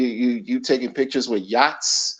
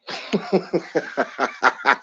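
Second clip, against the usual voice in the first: real staccato chuckle; a long string of quick ha-has; laughing; 5 dB quieter than usual. Real, not acted. Host amused that Richard spanked y'all, real laughing.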